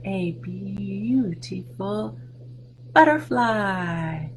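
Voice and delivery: slow, drawn-out reveal. Acted. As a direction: savor it for the kids. Revealing the answer.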